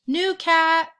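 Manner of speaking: high, loud calling. A narrator's reading. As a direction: call out for the lost cat. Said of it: "he called".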